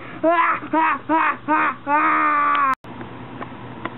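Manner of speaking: long villainous cackle; hammed-up evil laughter. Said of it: "do it again, but this time in an evil way".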